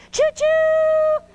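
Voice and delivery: high, loud "Choo-choo!" like a steam whistle; train whistle call. Pretend. Teacher playing train for the children, high loud whistle call. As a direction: toot it out like a train whistle for the kids.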